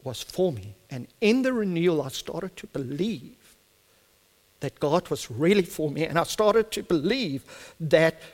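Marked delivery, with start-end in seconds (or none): none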